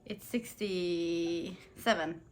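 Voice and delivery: usual speaking voice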